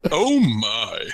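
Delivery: lightly voiced deep Takei "Oh my"; a hint of a mock Takei exclamation; George Takei voice. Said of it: "in George Takei's voice".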